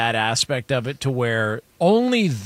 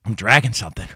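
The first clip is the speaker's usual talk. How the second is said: imitating dad; faster than usual. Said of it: "he's like".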